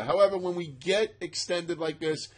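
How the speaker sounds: ordinary talk, nothing marked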